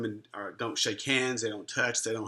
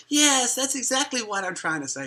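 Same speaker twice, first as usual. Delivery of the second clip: much higher, a touch louder eager yes; father's eager reply; 12 semitones higher, 4 dB louder than usual. Retold or imitated. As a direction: blurt it out high and glad. Father answers son.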